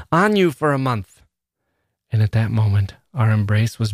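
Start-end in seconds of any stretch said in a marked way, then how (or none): 0.1-1.2 high, exultant call; impassioned high-pitched outburst; excited shout. A narrator's reading. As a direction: cry it out with pitch up. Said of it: "I shouted in my excitement".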